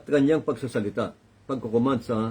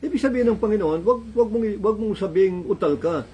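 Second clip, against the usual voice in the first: fervent preaching; 7 semitones higher than usual. Acted. Preaching at full force.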